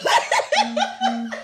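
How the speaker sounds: a high squealing burst of laughter; high shrieking laugh. Real, not acted. Laughing at Monica's fall, high shrieking laugh.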